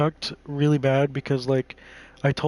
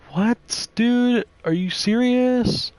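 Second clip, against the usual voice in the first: high-pitched, louder incredulous outburst; slow, raised cry of outrage at a boyfriend; incredulous disbelief; 10 semitones higher, 3 dB louder, much slower than usual. Retold or imitated. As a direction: throw it back at him in disbelief. Retold argument, exasperated disbelief.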